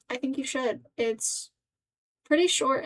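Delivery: usual speaking voice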